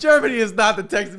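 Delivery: loud, high laughing objection; laughing protest. Real, not acted. Co-host rejecting the Germany-as-Texas joke, laughing loudly.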